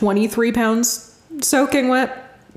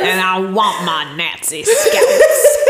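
Lieutenant Aldo; 4 dB louder than usual. Retold or imitated. Quoting the Tarantino film, Southern lieutenant impression. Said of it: "Lieutenant Aldo".

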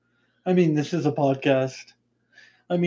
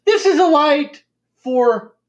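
emphatic; 9 semitones higher, 7 dB louder, slower than usual. Real, not acted. Exaggerating the lighting.

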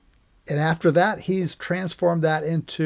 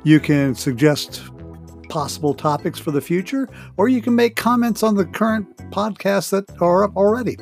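louder, lifted promo delivery; podcast promo read; 2 semitones higher, 5 dB louder than usual. Acted. Host inviting listeners to send comments, promo read. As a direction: pitch it to listeners with punched key words. Scripted promo over music.

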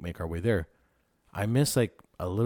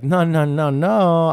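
louder, higher no's building in insistence; protesting refusal; 8 semitones higher, 12 dB louder, much slower than usual. Retold or imitated. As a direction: refuse to give up the shirt, childishly. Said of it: "And I was like".

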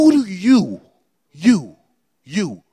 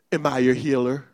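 ordinary talk, nothing marked

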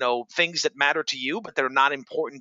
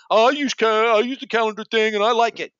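higher, louder mock salesperson voice; mock defensive salesperson; 7 semitones higher, 4 dB louder than usual. Host imitates salespeople defending calendar links, mock-whiny. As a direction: play the salesperson who loves his calendar tool. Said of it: "are gonna go".

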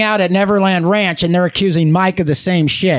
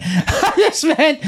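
laughing; 10 semitones higher, much slower than usual. Real, not acted. Laughing while explaining himself.

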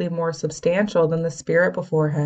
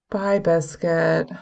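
slow, sad farewell; sad goodbye; slower than usual. A narrator's reading. Stormy saying goodbye to her late dog, sadly. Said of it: "Stormy said sadly".

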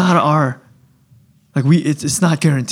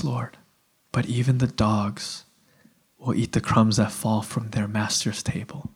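soft, low, submissive admission; humble plea; 6 semitones lower, 7 dB quieter than usual. Acted. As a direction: speak softly and lowly, as one unworthy before the Lord. Acting the humble woman pleading unworthiness.

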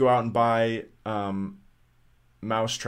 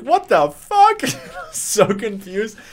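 much higher and louder, slow; shocked shriek; 11 semitones higher, 7 dB louder, much slower than usual. Retold or imitated. Finding a bird indoors, a high shocked shriek.

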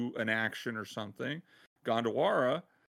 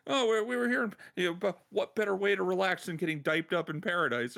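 breezy gangster; 7 semitones higher, faster than usual. Retold or imitated. Gangster explains his vacation.

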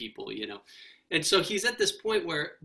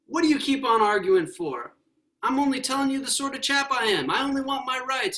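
higher, louder, faster protest; indignant ghost; 9 semitones higher, 4 dB louder than usual. Acted. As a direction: insist on your rights, getting heated. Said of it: "the ghost".

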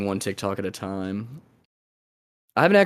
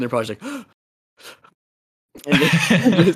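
mock gasping for air; out of breath; 7 semitones higher, 3 dB louder, much faster than usual. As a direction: pant like after a sprint. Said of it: "you can't breathe".

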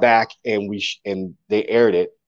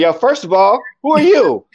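high, louder, incredulous demand; indignant challenge; 12 semitones higher, 6 dB louder than usual. Retold or imitated. Poet to an unknown caller asking for his details, indignant. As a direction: challenge a stranger who got too personal.

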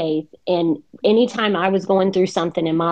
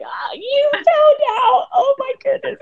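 embarrassed laughing. Retold or imitated. Retelling being caught out by the preacher, high laughing squeal. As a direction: relive the mortified giggle. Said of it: "I was like".

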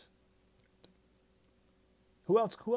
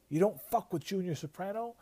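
higher, fired-up Mikey line; hammed-up tough-guy outburst; mock hothead outburst; 7 semitones higher than usual. Mikey losing his head at Junior, mock heated.

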